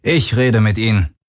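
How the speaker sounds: plain reading voice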